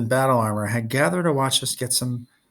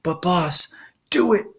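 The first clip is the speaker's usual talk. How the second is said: screamed; 6 semitones higher, slower than usual. A narrator's reading. Luke ordering the bear giant below, screaming. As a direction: snap the order, furious. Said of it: "Luke screamed".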